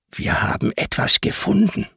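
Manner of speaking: hushed secret whisper over the counter; secretive boy whispering; conspiratorial whisper. Acted. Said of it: "antwortet der leise und verschwörerisch".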